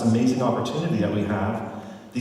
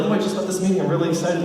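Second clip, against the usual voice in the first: mock excited; 7 semitones higher, much faster than usual. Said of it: "you're really excited".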